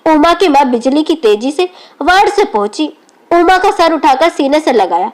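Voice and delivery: ordinary delivery, nothing marked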